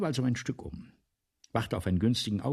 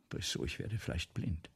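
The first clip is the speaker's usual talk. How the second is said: hushed confession; 4 semitones lower, 7 dB quieter than usual. Acted. Writer confesses possible blindness.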